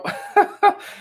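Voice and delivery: breathy high burst of laughter; airy laugh. Real, not acted. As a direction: laugh under your breath at the comment. Laughs while reading comment.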